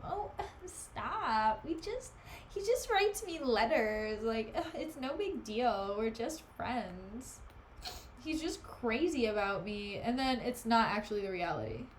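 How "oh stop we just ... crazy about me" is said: slow, drawn-out mock pick-me girl. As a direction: put on a coy pick-me act, dragging the words. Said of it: "gives me that kind of vibe".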